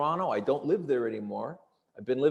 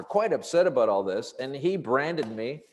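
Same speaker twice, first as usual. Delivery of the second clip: usual speaking voice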